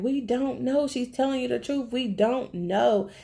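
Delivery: high-pitched retold plea; mock pleading. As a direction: imitate a friend begging to be believed. Acts out friend pleading during beating.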